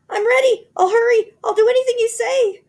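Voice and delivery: very high, very loud frantic eagerness; frantic wife promising anything; high loud frantic cry; desperate cry. A narrator's reading. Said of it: "cried Missus Twit".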